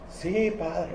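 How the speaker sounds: a soft, quiet little yes to father; change the voice and say it gently; disguised son answering softly; softened voice. Retold or imitated. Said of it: "suavecito le cambió la voz".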